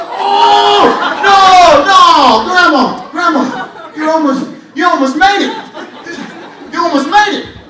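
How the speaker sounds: loud, high laughing protest; laughing shout at grandma, high and loud. Retold or imitated. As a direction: cry out through laughter at grandma. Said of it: "I said".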